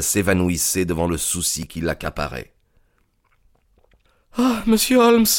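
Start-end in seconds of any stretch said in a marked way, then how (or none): 4.4-5.3 high, urgent cry of relief; a young woman's high, anxious exclamation; agitated cry. A narrator's reading. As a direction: burst out in agitation. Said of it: "s'écria-t-elle très agitée".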